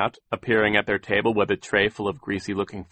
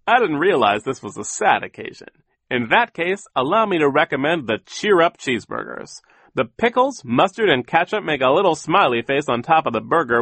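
high, loud, sing-song server's pitch; overeager waiter; 7 semitones higher, 6 dB louder than usual. A narrator's reading. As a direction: sell the menu with bouncy, swooping cheer.

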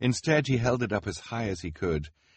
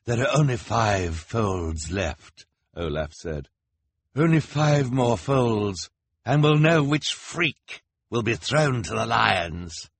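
higher, louder, slow teasing; higher, louder, slow gloating count; showman villain; 5 semitones higher, 5 dB louder, slower than usual. A narrator's reading. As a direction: draw out the suspense. Olaf teasing crowd.

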